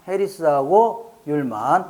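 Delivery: normal talking voice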